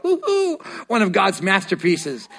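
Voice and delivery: high, laughing "Ooh" of delight; delighted gush. Shown as a demonstration. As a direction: admire yourself in the mirror with glee. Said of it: "when you look in the mirror, go".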